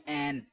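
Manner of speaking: short عین said as a plain "ain"; common mispronunciation. Shown as a demonstration. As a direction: say عین quickly with a simple open "e" vowel. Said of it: "اکثر لوگ کیا پڑھتے ہیں".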